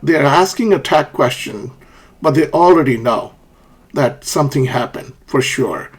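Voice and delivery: ordinary delivery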